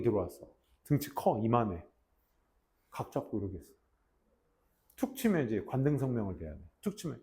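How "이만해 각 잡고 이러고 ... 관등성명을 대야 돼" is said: usual speaking voice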